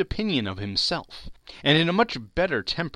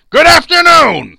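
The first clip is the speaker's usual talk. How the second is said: much louder, higher roar of dismissal; furious shout; 21 dB louder than usual. Acted. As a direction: bellow him out the door. Scrooge's final dismissal.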